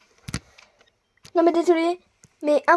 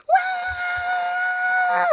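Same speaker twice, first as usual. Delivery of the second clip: high startled cry. Acted. Prisoner yelps at a noise in the night. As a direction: cry out in shock.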